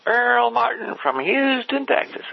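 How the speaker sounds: slow, high Texas twang on the phone intro. Retold or imitated. Earl introducing himself on a call, hammed-up Texas twang. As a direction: drawl the name out like a Houston cotton-farm boy. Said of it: "Do it in his voice".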